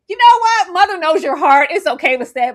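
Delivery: loud, high start, easing down; lightly played mama, big then settling; mock doting mother. Fan playing mother to Lestat, mock consoling.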